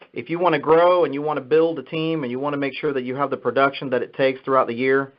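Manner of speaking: ordinary talk, nothing marked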